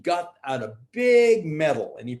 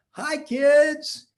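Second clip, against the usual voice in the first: high, louder cheery hello; cheery parent greeting; 12 semitones higher, 4 dB louder than usual. Acted.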